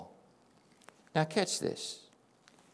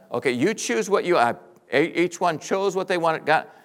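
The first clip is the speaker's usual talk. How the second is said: ordinary delivery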